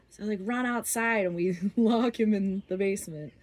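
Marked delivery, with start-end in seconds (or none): none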